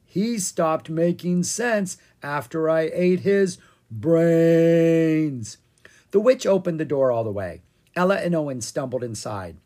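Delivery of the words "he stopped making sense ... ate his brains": slow, drawn-out zombie moan; zombie groan. A narrator's reading. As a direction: keep moaning like a zombie. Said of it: "Ella groaned".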